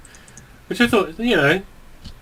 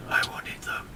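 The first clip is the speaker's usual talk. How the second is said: soft unvoiced aside; whisper; 13 dB quieter than usual. Real, not acted. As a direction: whisper it under your breath.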